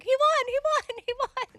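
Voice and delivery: high, loud re-enacted celebration; excited cheering. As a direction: cheer it like an overjoyed supporter. Retelling the group's winning celebration.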